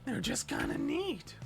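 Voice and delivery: high, hammed-up Marge try; a hint of Marge; Marge voice attempt. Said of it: "I can't do it".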